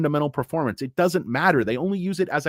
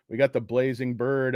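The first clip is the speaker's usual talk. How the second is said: ordinary talk, nothing marked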